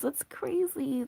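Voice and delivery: slow, hushed whisper of amazement. Real, not acted. Reader marveling at the card to viewers, slow whisper. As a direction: let it out under the breath, amazed. Reacts to the card she drew.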